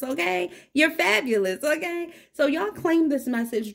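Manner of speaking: sassy encouragement; hype. Real, not acted. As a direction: pump the viewer up. Hyping the viewer.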